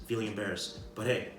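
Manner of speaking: ordinary talk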